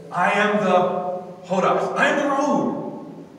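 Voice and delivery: loud, high declarations with stretched key words; ringing, insistent pulpit delivery; full-force preaching. Acted. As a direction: preach it out emphatically to the congregation.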